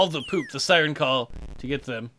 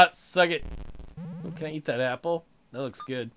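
normal talking voice

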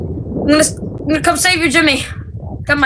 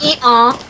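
hiccup; 3 dB louder than usual. Real, not acted. Hiccups named at line 12.

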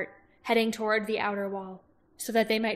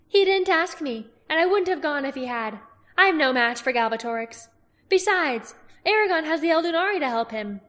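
higher, louder female character voice; Angela's lilting voice; 7 semitones higher, 7 dB louder than usual. Acted. Herbalist telling Roran of Aragorn, higher and louder. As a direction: play the sly herbalist. Woman's voice in dialogue.

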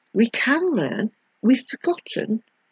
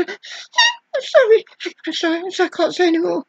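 mock tearful apology; 6 semitones higher than usual. Mocking an interviewee breaking down, high and tearful. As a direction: fake a choked-up TV apology.

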